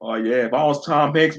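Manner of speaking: usual speaking voice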